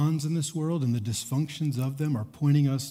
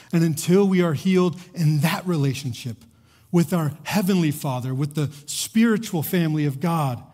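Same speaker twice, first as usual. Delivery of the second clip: rising preaching; 5 semitones higher than usual. Acted. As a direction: drive the point home to the congregation. Preacher builds to climax.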